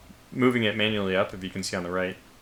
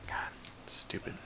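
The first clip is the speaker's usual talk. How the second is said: whispering; 15 dB quieter than usual. Real, not acted. Annoyed aside, submarine stopped.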